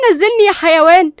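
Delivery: loud, high furious yell; screaming insult. Acted. Linda on Qusay's shoulder, screaming at him. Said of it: "صرخت ليندا بصدمة".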